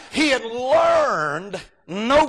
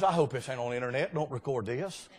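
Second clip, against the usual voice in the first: quick, softer aside, half-laughing; hushed aside; 10 semitones lower, 12 dB quieter, faster than usual. Real, not acted. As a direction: drop to a hushed confidential aside. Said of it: "aside to the congregation".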